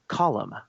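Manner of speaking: "Colum" said in two syllables, COL-um; two-syllable pronunciation. Shown as a demonstration. Host raising the disputed name, "Colum" in two syllables. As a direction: say "Colum" with a second vowel before the m. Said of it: "it's actually pronounced".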